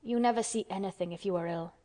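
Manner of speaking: ordinary delivery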